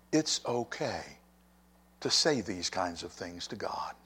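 ordinary talk, nothing marked

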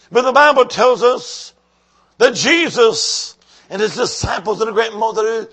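ordinary talk

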